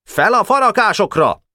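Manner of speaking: high-pitched attack order; shouted command. A narrator's reading. Pásztor ordering his men up the woodpiles, shouted command. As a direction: order the climb in a high cry. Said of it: "Pásztor újra parancsot adott".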